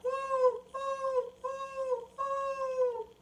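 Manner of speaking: high hooting owl call, woo woo. Retold or imitated. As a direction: hoot like an owl in the backyard. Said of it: "And it goes".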